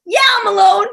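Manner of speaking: high and loud mock despair; sarcastic retort. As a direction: throw it back, sure I'm alone.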